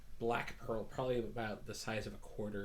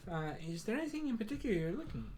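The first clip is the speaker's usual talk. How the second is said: higher, bright-pitched welcoming shop cat; Moon the Tabaxi shopkeeper; 6 semitones higher, faster than usual. Acted. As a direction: greet customers grandly in a high voice. Moon answers the customer.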